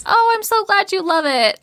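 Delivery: high-pitched, sweetly gushing reply; gushing thanks. Retold or imitated. Writer answering friends' praise, gushing thanks.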